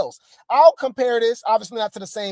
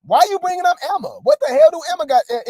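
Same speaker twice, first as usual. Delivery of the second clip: much higher, faster, rising to a squeal; high mocking Daisy; 8 semitones higher, 5 dB louder, faster than usual. Streamer mocks Daisy's reply in a squeaky voice. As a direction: squeak it out in outrage at being dragged in. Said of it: "Daisy, like".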